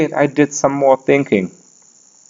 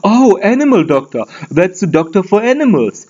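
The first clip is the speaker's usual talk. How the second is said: higher, explaining the obvious; mock-logical voice; obvious reasoning voice; 6 semitones higher than usual. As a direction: spell it out like it's self-evident. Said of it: "So put it together".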